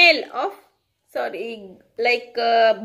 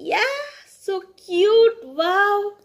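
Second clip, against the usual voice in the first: cooing; 6 semitones higher, 3 dB louder, slower than usual. Acted. Cooing over puppy picture.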